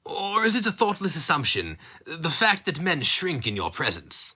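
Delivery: lilting, mocking interrogator; taunting villain. Captor taunting the bound man, lilting and mocking. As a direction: let the question curl with mock curiosity.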